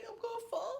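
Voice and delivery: high whispered fear; scared whisper. Retold or imitated. On the slippery rocks, high frightened whisper. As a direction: hush it, sure she'll slip. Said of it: "She say".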